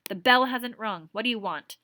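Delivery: teacher sternly addressing a student; severe. Acted. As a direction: clip it like a strict teacher. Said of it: "she said rather severely".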